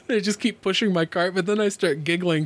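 plain reading voice

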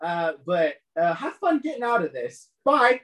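high-pitched, mocking villain voice; haughty, high put-on voice full of scorn; contemptuous, proud. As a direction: sneer a smug farewell from on high. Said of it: "voice full of contempt and pride".

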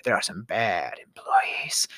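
hushed, slow repeat in a whisper; breathy whispered aside. Real, not acted. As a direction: whisper it like a secret.

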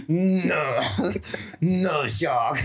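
put-on tough-guy voice; retry the action-star line; mock action-hero delivery; Jason Statham. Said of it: "back to the Jason Statham voice".

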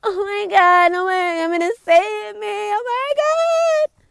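high-pitched, drawn-out whiny wail; hammed-up high whine; mock whining. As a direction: mimic a helpless whiner, last words stretched way up. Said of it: "I'm tired of everybody whining".

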